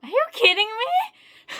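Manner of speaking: loud, high shriek of disbelief; screaming are you kidding me; excited shout. Real, not acted. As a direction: yell in happy shock. Opening a card.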